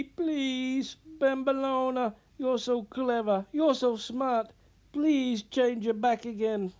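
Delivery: high, loud tearful pleading. Acted. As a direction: beg through tears, desperately. Sobbing apprentice begs forgiveness.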